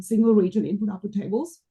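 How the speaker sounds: ordinary delivery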